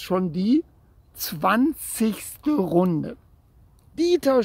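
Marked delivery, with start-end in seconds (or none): 4.0-4.4 high, louder call for his brother; desperate shout. A narrator's reading. Boy calls his brother from the runaway bike, desperate high shout. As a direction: cry out the name for help. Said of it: "schrie er".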